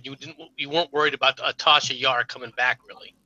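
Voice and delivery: normal talking voice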